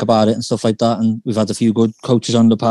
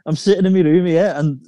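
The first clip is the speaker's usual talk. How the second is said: muffled, like through a wall; 7 semitones higher than usual. Real, not acted. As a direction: sound dulled and boxed in. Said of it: "you sound like you've got a bit muffled".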